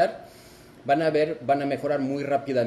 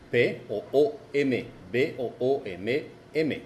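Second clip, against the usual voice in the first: usual speaking voice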